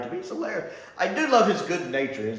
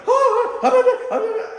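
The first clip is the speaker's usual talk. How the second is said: high, loud panicky squeal; mock panic; 8 dB louder than usual. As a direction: ham up a terrified sailor in a high squeal. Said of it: "they see a Dalek and they're".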